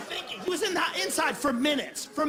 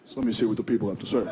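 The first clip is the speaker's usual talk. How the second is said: sly Obama voice; 14 semitones lower, faster than usual. Acted. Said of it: "he could Google his own name".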